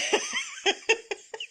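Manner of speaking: a burst of shrill laughter, way up high; high squealing laugh. Real, not acted. Laughing at her own accent.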